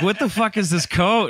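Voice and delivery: high-pitched retold disbelief; incredulous complaint. Actor to filmmaker about the costume coat, incredulous.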